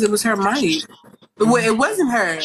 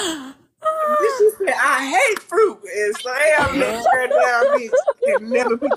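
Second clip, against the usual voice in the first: excited retelling; 8 semitones higher than usual. Real, not acted. Excited retelling laughing shout.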